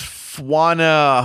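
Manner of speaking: "Fwana" said as FWAH-nuh; tentative pronunciation. Shown as a demonstration. Reading an unfamiliar first name aloud, tentative pronunciation. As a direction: try "Fwana" with a w glide after the F. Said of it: "Is that how you pronounce that?".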